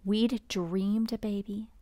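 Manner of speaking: plain reading voice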